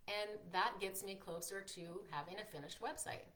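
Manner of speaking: ordinary talk, nothing marked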